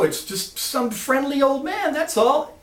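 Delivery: high, puzzled shrugging-off; innocent protest. Retold or imitated. Lance answering his alarmed boss, high innocent protest. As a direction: explain it away in a high voice. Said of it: "And Lance says".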